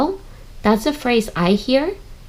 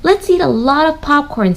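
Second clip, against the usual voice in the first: Katie's voice; 7 semitones higher, 4 dB louder than usual. Acted. Teacher voicing Katie in read-aloud.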